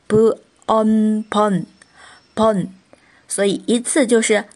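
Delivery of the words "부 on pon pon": 부, then "on", joined slowly into "pon"; sounding out. Shown as a demonstration. Teacher adding the consonant to the earlier result, slow 부-on-pon blending. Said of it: "拿部辅音去拼读".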